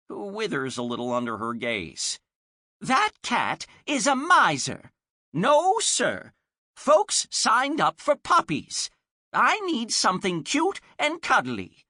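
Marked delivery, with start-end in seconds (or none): 2.8-11.8 put-on high, loud, bossy old woman; bossy old lady. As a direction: scold him like a stubborn granny. Old woman scolds shop owner.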